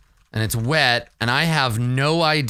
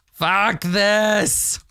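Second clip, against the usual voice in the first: higher, drawn-out angry cry; angry outburst; 7 semitones higher than usual. Acted. Player voices the fed-up climber, angry outburst. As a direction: stretch it out into an exasperated yell.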